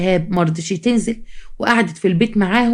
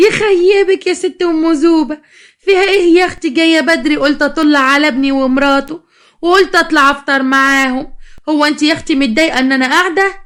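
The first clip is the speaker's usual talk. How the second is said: mocking retort; 6 semitones higher, 3 dB louder than usual. Mother-in-law answers the bride's mother's jab, mocking and high-pitched. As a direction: scoff in a raised sing-out voice.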